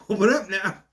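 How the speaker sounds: loud, high mock Southern accent; put-on Southern call, high and loud. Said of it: "This is kind of Southern".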